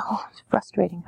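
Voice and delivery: softly whispered sympathy; soothing whisper. Shown as a demonstration. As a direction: whisper to calm the child.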